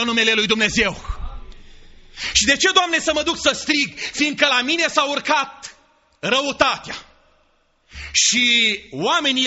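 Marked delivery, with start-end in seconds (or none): 2.3-7.0 indignant Jonah. Acted. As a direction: throw the question back at God, indignant. Jonah protests God's command. (Iona protestează indignat, mai sus și mai tare)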